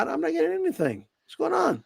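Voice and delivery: high, soft whine; high whiny voice. Pretend. Voicing complaint to sister-in-law.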